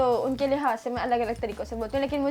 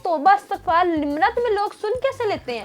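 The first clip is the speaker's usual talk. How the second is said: mock horror; 5 semitones higher than usual. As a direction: throw up your hands at your own voice. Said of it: "मैंने कहा".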